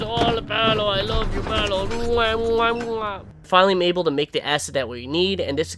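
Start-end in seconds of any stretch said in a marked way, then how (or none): 0.0-3.2 high, blown-kiss PewDiePie voice; Swedish accent. Retold or imitated. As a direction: Swedish YouTuber gushing, higher. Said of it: "I have a Swedish accent".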